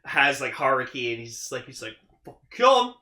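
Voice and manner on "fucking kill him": loud, high put-on villain order; playacted menacing shout; mock threat. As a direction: bark out a hammed-up kill order.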